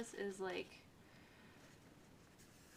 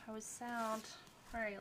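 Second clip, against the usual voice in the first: usual speaking voice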